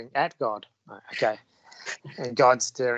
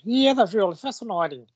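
mock impressed; 8 semitones higher, 5 dB louder than usual. Ironic praise of the impression.

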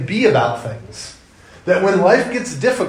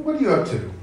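lightly voiced suspicious dad, softer; 3 dB quieter, faster than usual. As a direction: quiz the kid gently, half-suspicious. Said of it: "a child will be hiding something".

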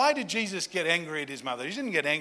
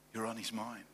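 soft reassurance; 9 semitones lower, 12 dB quieter than usual. Real, not acted. Pastor reassuring the congregation, hushed and much softer. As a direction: drop it right down, much softer and lower.

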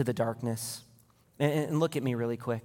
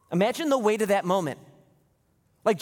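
higher, louder building preaching; rising sermon intensity; full-force preaching; 7 semitones higher, 6 dB louder, faster than usual. Acted. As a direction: build the scene up for the church.

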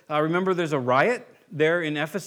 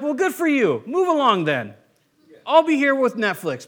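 sarcastic brush-off; 8 semitones higher, 7 dB louder than usual. Retold or imitated. Dismissive friend quote.

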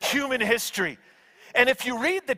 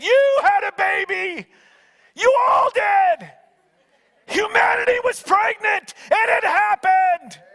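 excited angel proclamation; 10 semitones higher, 5 dB louder than usual. Retold or imitated. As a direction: proclaim it with overwhelming joy.

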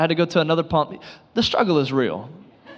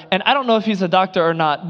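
normal talking voice

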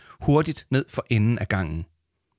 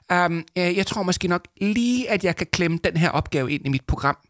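high, excited voice holding back her joy; trying to sound cool while bursting with excitement; eager young woman; 9 semitones higher than usual. Acted. Young woman playing it cool about the job offer, excitedly. Female character voiced in story.

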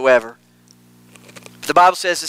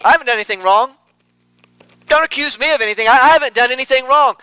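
mock denial; 7 semitones higher, 3 dB louder, faster than usual. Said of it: "She eats and wipes her mouth".

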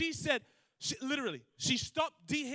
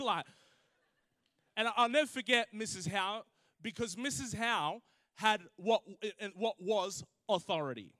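normal talking voice

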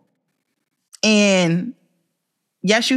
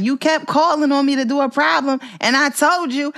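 higher-pitched mock kid protest; upset child imitation; 6 semitones higher than usual. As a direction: imitate an upset boy talking back, voice lifted. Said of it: "He said it like that".

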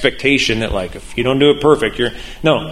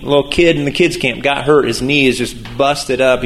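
ordinary delivery

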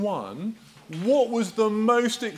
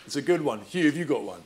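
ordinary talk, nothing marked

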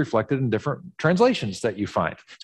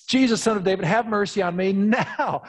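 high, insistent call; audacious demand; 6 semitones higher than usual. Acted. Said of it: "to have the audacity to sort of demand it".

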